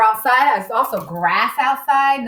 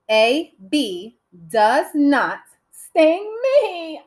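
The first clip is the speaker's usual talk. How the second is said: slow, higher and spaced out; word by word; 5 semitones higher, 4 dB louder, much slower than usual. Shown as a demonstration. Teacher composing an eight-word sentence aloud, slowly word by word. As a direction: count out each word as you go. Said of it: "Eight words in that sentence".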